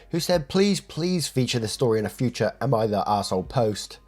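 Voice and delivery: usual speaking voice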